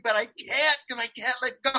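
giggling as he tells how stuck he was; laughing. Real, not acted. Telling friend about the bug, laughing.

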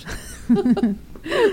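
high, wheezy witch cackle; witch laugh. Acted. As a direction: cackle like an old hag. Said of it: "That's my sick witch laugh".

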